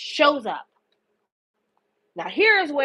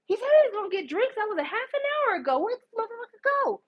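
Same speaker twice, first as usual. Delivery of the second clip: high-pitched, quick bewildered whine; lost child; 8 semitones higher, 3 dB quieter, faster than usual. Retold or imitated. Abandoned boyfriend on the dance floor, lost-child whine. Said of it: "looking like a lost child talking about".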